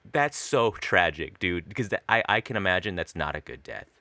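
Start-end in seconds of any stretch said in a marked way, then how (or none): none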